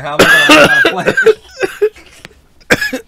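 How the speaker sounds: high, slow, laughing outburst; exasperated laughing shout. Retold or imitated. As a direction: cry out in mock despair. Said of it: "I'm like".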